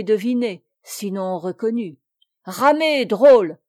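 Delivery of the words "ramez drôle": furious order, much louder, voice strangled; strangled angry shout at the oarsmen; choked with anger. A narrator's reading. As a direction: bark at the rowers in rage. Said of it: "d'une voix étranglée par la colère".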